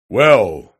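loud, booming roar of a word; big roaring voice. A narrator's reading. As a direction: roar it out at the visitor. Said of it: "he said in a roaring voice".

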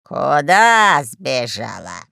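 low, drawn-out displeased demand; heavy, stretched-out anger; angry. A narrator's reading. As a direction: demand it slowly, deep and cross. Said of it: "рассердился хозяин Сиенды".

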